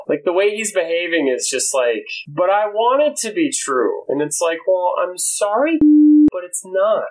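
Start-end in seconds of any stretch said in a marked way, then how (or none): 2.3-4.0 put-on higher, drawn-out childish plea; slow, pitched-up babyish protest; mock infant whine. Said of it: "like, an infant now". 4.7-7.0 mock consoling. Mock-consoling reply to the infant.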